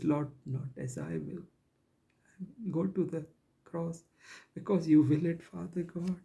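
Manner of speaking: really moved, barely getting the words out; emotional. Real, not acted. Preacher voicing the Savior's prayer before the cross, overcome with emotion. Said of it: "I get emotional".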